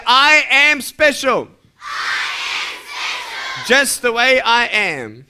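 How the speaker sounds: slow, loud rallying line; call for repetition. Shown as a demonstration. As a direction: lead everybody in saying it, slowly and loudly. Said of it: "everybody say".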